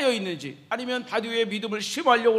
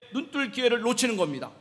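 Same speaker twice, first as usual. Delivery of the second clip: ordinary delivery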